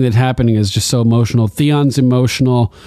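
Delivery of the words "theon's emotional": ordinary talk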